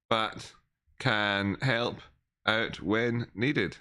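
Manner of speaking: halting, soft, words parcelled out with gaps; distracted dual-tasking. Real, not acted. Streamer struggling to dual task, distracted and halting. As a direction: speak absently in pieces while busy with another task. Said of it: "I struggle to dual task".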